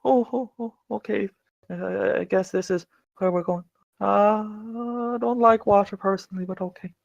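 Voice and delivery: small, high, hesitant voice; quiet. Acted. Timid character about to follow into water, quiet voice. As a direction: reluctantly talk yourself into it, softly.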